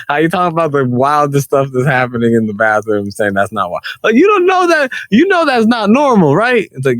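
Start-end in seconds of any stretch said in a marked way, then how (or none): 4.0-6.7 incredulous. Real, not acted. Arguing it's wild.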